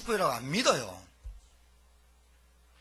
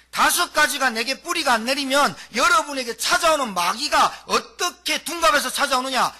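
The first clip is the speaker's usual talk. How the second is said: preaching at full force; 6 semitones higher, 6 dB louder than usual. Acted. Preacher proclaiming identity to the congregation, full force. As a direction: proclaim it to the whole church. Preacher asks rhetorical question.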